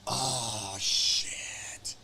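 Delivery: whispered oh shit, there he is; hushed oh shit. Retold or imitated. Fighters seeing their opponent, whispering in awe. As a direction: breathe it out like you just spotted him. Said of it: "everyone says".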